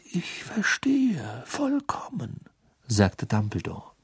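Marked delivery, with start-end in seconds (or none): 0.0-2.4 langsam, leise gehaucht; sanftes Flüstern. A narrator's reading. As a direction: beruhige ihn ganz leise. Dumbledore beruhigt Harry. (leise und langsam geflüstert)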